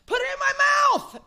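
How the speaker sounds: shrieked high inner monologue; wild shout. Retold or imitated. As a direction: scream it out, inner lust bursting. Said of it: "inner monologue".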